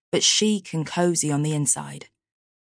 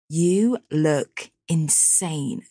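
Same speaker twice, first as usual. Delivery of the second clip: drawled compliment; much slower than usual. Acted. Hannah greets Tia admiringly.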